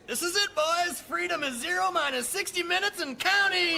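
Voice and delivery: high, loud whooping shout; excited cheer. Acted. Cadet cheering his buddies before graduation, excited shout. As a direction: cheer it like the countdown to freedom.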